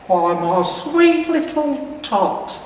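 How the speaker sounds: high, loud, slow syrupy Santa voice; fake sweet Santa. Grinch fooling Cindy Lou, syrupy fake Santa. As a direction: lay the false kindness on thick. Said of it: "the fake Santa Claus lied".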